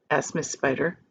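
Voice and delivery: usual speaking voice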